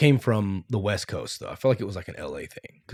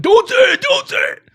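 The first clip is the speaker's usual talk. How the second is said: high frantic shouting; 8 dB louder than usual. Retold or imitated. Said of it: "everybody else would be like".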